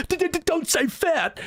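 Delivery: high, slow whiny stammer; mock stuttering Palmer. Palmer stammering at Sid as he storms out, high slow whiny. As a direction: whimper it as a hurt coward. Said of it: "Palmer's like".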